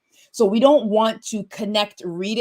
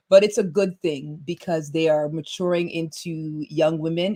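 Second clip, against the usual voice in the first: usual speaking voice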